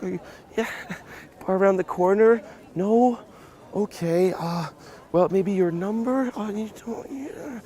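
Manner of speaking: hammed-up unsure asking, very high; permission-seeking tonality. Shown as a demonstration. Pickup coach mimicking a man fishing for her number, permission-seeking. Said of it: "there's that little tonality".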